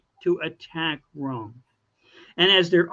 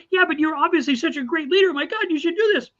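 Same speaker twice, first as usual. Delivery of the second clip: higher, fast, gushing flattery; mock-eager crowd begging him to lead; fawning citizens; 10 semitones higher, faster than usual. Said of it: "Not, you know".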